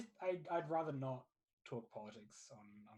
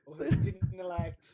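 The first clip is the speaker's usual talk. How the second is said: louder and higher, cutting the topic off; exasperated shout; 10 semitones higher, 10 dB louder than usual. Real, not acted. Streamer stops friends talking elections, in an exasperated shout. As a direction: shout them down before politics starts. Shutting down politics talk.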